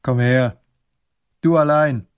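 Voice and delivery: loud, gruff order; growled. A narrator's reading. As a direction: order him over curtly. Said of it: "knurrt er".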